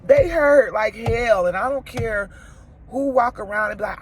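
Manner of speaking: sobbing loud and high in a real vlog confession; crying out loud. Real, not acted. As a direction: let the hurt break through, real tears. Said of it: "being vulnerable and crying".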